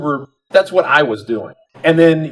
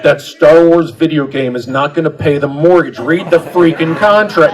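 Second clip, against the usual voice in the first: mock stern dad; 3 semitones higher, 7 dB louder than usual. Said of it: "No, I didn't say that".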